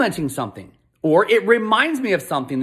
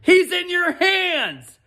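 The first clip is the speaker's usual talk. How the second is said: pitched way up, slow and exasperated; exasperated dad; 11 semitones higher, much slower than usual. Retold or imitated. Dad telling son the toy is in his hands, high and exasperated. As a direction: let the disbelief climb high and drag out. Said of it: "I'm like".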